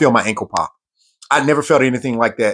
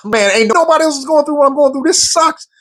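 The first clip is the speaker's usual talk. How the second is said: much higher, fast, strained complaint; frustrated whine; 13 semitones higher than usual. Retold or imitated. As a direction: whine like a worn-out recruit at his limit. Frustrated recruit's inner complaint.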